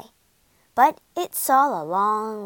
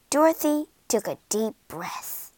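ordinary delivery

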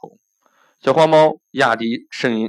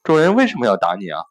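ordinary delivery, nothing marked

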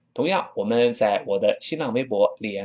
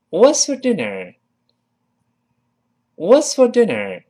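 What's for dinner, higher and louder; model question; 7 semitones higher, 5 dB louder than usual. Shown as a demonstration. Teacher models the question for students to repeat. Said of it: "跟我念".

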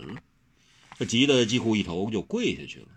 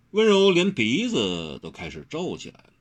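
ordinary delivery

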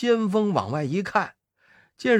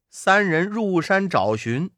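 usual speaking voice

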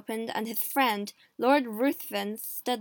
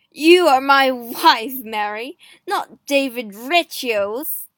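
slow, loud, possessive; jealous rebuke; 10 dB louder, slower than usual. A narrator's reading. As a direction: hammer out each word. Jealous husband rebukes wife.

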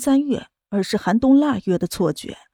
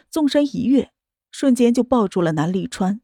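ordinary delivery

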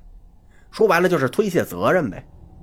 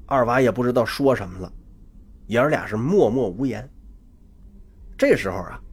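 usual speaking voice

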